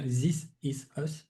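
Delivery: French speaker's halting English accent. Real, not acted. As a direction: spell out the English title word by word, French-accented. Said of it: "Mon accent anglais, il est vraiment terrible".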